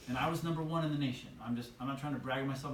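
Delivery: ordinary delivery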